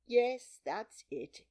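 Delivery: resigned, sighing agreement; mild weary resignation. A narrator's reading. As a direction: agree with a sigh. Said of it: "said the Hatter with a sigh".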